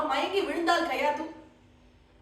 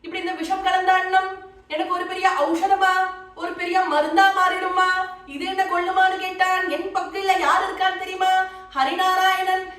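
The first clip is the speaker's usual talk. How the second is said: higher, louder voice of a devout child, retold; fervent assurance; 4 semitones higher, 4 dB louder than usual. Prahlada assures his mother God is with him, raised fervent voice. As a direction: press the point with raised, steady conviction.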